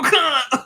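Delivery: high, loud sneezy squeal of a laugh; a nerdy sneeze-like yelp; starstruck snort-laugh. Retold or imitated. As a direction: let out a startled fan snort. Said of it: "sneeze sort of thing".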